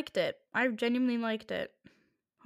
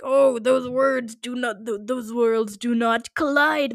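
slow, louder, drawn-out mock lecture; mock retort; 7 dB louder, slower than usual. Answering the Twitter commenter, a slow loud mock scolding. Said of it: "and it's like".

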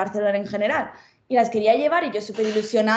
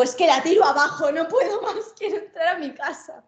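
rising, higher pitched frustrated outburst; mock exasperation; 5 semitones higher than usual. Locked out of the flat, retelling her fed-up outburst. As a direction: vent like you'll break the door down.

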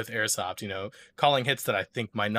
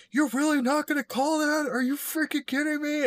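high, put-on voice of an angry player mocking a cheater; mock angry yell. Retelling the airsoft player who accused him, shrill mock outrage. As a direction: imitate him whining at the call, pitched way up. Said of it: "he's walking back yelling".

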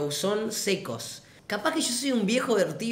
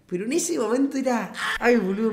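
usual speaking voice

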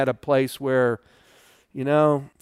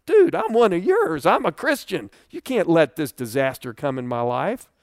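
very high, louder cry of protest; outraged plea; 4 semitones higher, 4 dB louder than usual. Retold or imitated. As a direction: demand God take your side. Complaining to God in memory.

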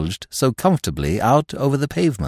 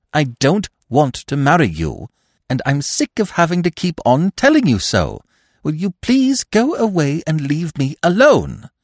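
sharp, louder and exasperated; hot-tempered; 8 semitones higher, 4 dB louder than usual. A narrator's reading. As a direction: snap it out, fed up. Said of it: "voice that came hotly through clenched teeth".

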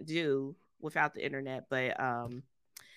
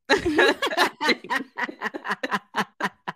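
long laugh; 8 semitones higher, 7 dB louder than usual. Real, not acted. Laughs after bless you.

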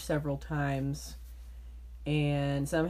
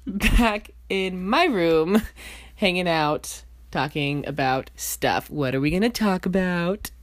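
loud, drawn-out sing-song; sing-songy; 6 dB louder, slower than usual. Real, not acted. Host opening the podcast as if to a small child, sing-songy. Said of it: "with a sing-songy voice".

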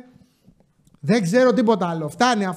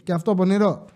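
ordinary talk

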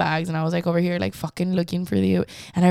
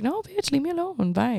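much higher, mocking dismissal; sassy brush-off; 8 semitones higher, 6 dB quieter than usual. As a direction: shoo him off with attitude. Rejecting old man.

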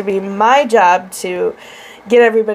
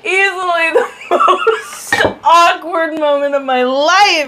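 slow, laughing through the words; 6 semitones higher, slower than usual. Real, not acted. Recalling the awkward funeral mix-up, laughing. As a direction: laugh as you sum it up.

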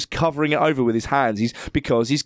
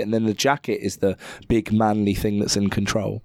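ordinary talk

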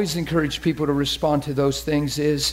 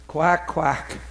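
duck quack; 3 semitones lower than usual. Pretend. Imitates a sitting duck.

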